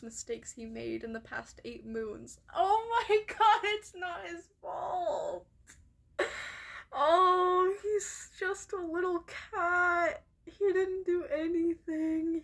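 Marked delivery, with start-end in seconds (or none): 2.5-10.2 crying out high, breaking into sobs; real tears over the poor cat; devastated. Real, not acted. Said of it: "this makes me so devastated". 10.4-12.4 tearful, leaning on HE; sobbing in protest for the cat; crying. Real, not acted. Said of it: "this makes me so devastated".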